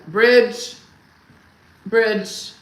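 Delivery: loud one-word shout, pointing; loud imitation of a husband pointing at a bridge; mock husband's shout. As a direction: bark it out like an eager tour guide. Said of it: "I said".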